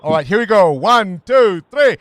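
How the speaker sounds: rousing count-in. Real, not acted. Host leads birthday song for young listener, rousing count-in. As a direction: count everyone in loudly for a song.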